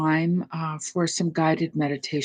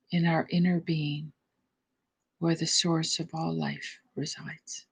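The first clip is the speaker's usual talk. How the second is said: guided meditation; 3 dB quieter than usual. Acted. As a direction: ease the listeners into stillness, unhurried.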